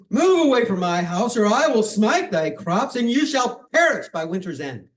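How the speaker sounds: loud, high, commanding curse; angry threat. Acted. Angry Amparo warns the mob from her tree, loud commanding threat. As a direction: thunder the threat down at them. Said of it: "she got mad".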